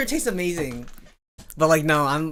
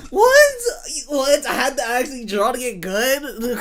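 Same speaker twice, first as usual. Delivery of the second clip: mock outrage; 7 semitones higher, 3 dB louder than usual. Real, not acted. Mock outrage at having to draw.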